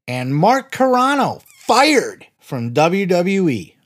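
high, slow hyped announcer read; announcer intro. Acted. Host opening the wrestling podcast, announcer hype. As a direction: sell the show like a big promo.

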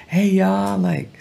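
a high, light Southern "hey y'all". Retold or imitated. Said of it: "she had that Southern accent".

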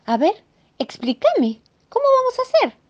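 a housewife demanding answers; exasperated Mrs Moe. Acted. Mrs Moe demanding how she'll paint now, exasperated high voice.

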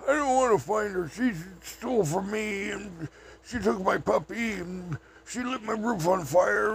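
high, whiny complaint; a high, aggrieved drifter's lament; upset Okie. Retold or imitated. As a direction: whine out the grievances high.

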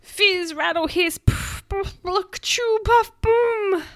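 high, loud, punchy noise words; comic storytime explosions; explosive sound effects. A narrator's reading. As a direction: fire off each noise, ending on a boom. Performs the fart's noise words.

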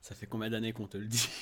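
usual speaking voice

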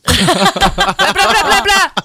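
high, loud alien babble; extraterrestrial language. Pretend. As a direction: squawk it out like a hammed-up alien. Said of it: "tu vas commencer à parler une langue extraterrestre".

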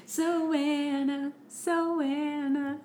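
high, lilting call of "Anna"; high singsong. Pretend. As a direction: sing it high and teasing. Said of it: "echoing his singsong".

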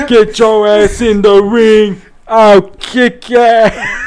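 put-on belligerent drunk. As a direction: slur a slow bar-fight challenge. Imitating Stone Cold Steve Austin.

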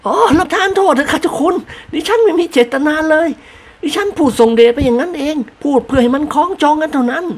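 high lady voice holding back laughter; suppressed laugh. A narrator's reading. Wife apologizes to her husband while stifling laughter. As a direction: apologize while barely hiding a giggle. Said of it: "กลั้นหัวเราะแทบแย่".

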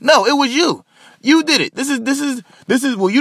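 high-pitched, heated outburst; real indignant accusation. Real, not acted. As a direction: jump up in pitch to throw the blame back. Rebutting the quoted apology, accusing.

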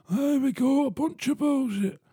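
mocking listener voice.

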